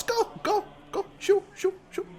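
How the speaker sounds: high, fussy priest shooing someone away. Acted. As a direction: brush him off in a high, fussy voice.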